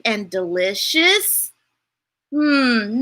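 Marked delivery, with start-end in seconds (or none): none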